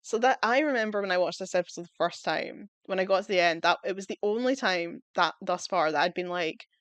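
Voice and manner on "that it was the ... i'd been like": ordinary talk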